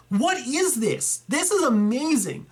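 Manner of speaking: viewer's collection leaves him floored; amazed. Real, not acted. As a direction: stretch it out, stunned. Awed by a huge collection.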